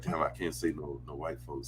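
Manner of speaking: very low, soft inner voice; imagined private thought. Retold or imitated. Voicing a guest's hesitation over naming actors, hushed mock worry. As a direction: murmur it like someone thinking to herself. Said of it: "She's like".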